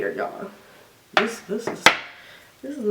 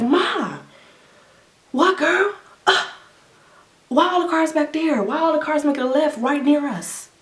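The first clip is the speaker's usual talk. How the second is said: high, startled questions to mom; alarmed in the car; 7 semitones higher than usual. Retold or imitated. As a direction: sound groggy and suddenly scared at the intersection. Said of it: "I'm like".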